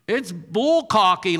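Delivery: ordinary delivery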